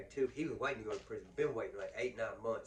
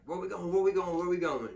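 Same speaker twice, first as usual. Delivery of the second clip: louder, higher, everyone clamoring at once; eager shouting; 7 semitones higher, 10 dB louder than usual. Retold or imitated. Inmates in the van begging to know where they're headed, loud and eager. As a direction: pester the guard with the same question. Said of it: "everybody's like".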